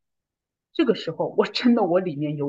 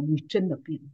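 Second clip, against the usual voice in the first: soft, lowered quote; retold husband's remark; 4 semitones lower, 7 dB quieter than usual. Husband tells wife she changed, softly retold. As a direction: say it quietly, as if struck by it. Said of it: "我先生说".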